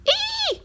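a sudden, very high squeal of triumph; shrill cry. A narrator's reading. Fifi finding a spool, very high triumphant squeal. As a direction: squeal it like you found treasure. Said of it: "Fifi poussa un nouveau cri".

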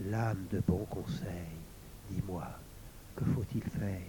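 breathless whispered plea; whispered question to the sword. A narrator's reading. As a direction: whisper it, barely voiced. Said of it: "Lauriane murmura".

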